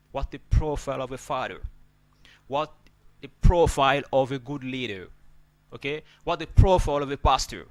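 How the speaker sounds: ordinary talk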